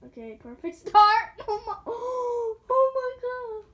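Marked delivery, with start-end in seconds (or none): 1.5-3.7 gasping panic. Real, not acted. Nearly falling, gasping.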